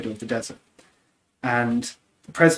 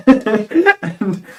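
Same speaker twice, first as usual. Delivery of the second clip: laughing; 10 semitones higher, 12 dB louder than usual. Real, not acted. Laughing at own punchline.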